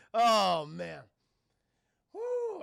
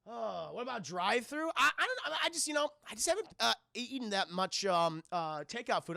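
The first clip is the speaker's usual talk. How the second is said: normal talking voice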